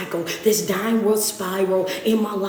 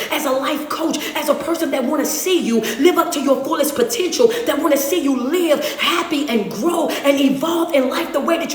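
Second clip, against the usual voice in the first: higher, louder preacher's push, building to a shout; preaching at full force; 5 semitones higher, 3 dB louder than usual. Acted. Life coach preaching hard truth to heartbroken viewers, full force. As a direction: drive the truth home like a pulpit preacher.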